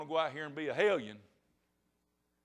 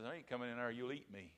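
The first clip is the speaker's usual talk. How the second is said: low, quiet refusal as the rabbit; storyteller's subdued rabbit voice; wary rabbit; 3 semitones lower, 12 dB quieter than usual. Acted. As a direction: mutter a wary little refusal.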